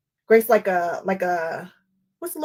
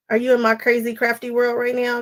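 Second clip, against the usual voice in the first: ordinary talk, nothing marked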